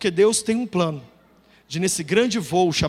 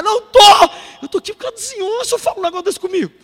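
higher, louder exclamation; rising indignant protest; heated mock indignation; indignant outburst; 11 semitones higher, 9 dB louder, faster than usual. Indignant climax of rant.

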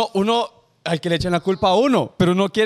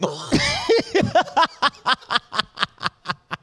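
carcajada larga y aguda; ríete sin parar, con voz aguda; 9 semitones higher than usual. Real, not acted. Risa tras el chiste del velorio. (risa aguda y prolongada)

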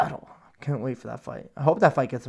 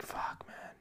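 breathy sad whisper; 17 dB quieter than usual. Retold or imitated. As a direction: barely breathe it out, choked up. Sad reaction to Kobe tributes.

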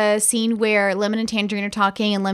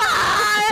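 mock scream; 10 semitones higher than usual. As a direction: imitate a scream in a high voice. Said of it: "Brad's just screaming with him".

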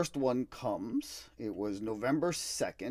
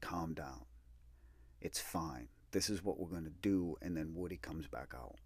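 hushed calm; 4 semitones lower, 8 dB quieter than usual. Retold or imitated. Dad whispers calmly in store.

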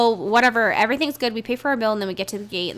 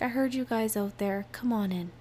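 gentle nurse; 10 dB quieter than usual. Retold or imitated. Kind nurse greeting crying girls.

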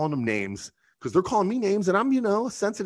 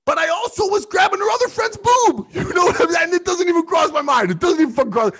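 excited shout; 7 dB louder than usual. Real, not acted. Laughing boast about grabbing boob.

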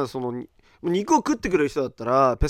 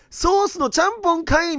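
comic retort shout; 13 semitones higher than usual. Real, not acted. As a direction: call him out like a comedian. Retort as sauces mixed.

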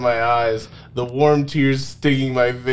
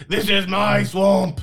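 Shrek ogre voice; 7 semitones higher than usual. Acted. Shrek throws her, voiced ogre.

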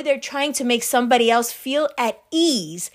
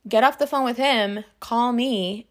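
ordinary delivery

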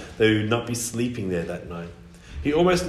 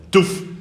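loud thud noise; 9 semitones higher, 6 dB louder than usual. Acted. Island bump sound.

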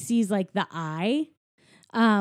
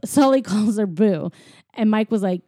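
usual speaking voice

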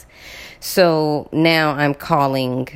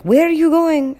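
put-on young driver; 12 semitones higher, 4 dB louder than usual. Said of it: "he's like".

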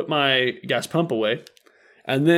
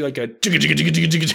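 rapid-fire juddering engine noise; imitated car rattle; 2 semitones higher, much faster than usual. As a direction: mimic a car shaking as it drives. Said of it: "My car is doing".

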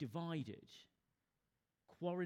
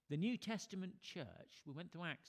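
usual speaking voice

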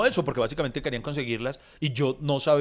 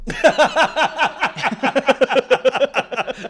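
long, high, loud belly laugh; big laugh; 9 semitones higher, 6 dB louder than usual. Real, not acted. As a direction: burst out in a long peal of laughter. Estalla de risa ante el chiste.

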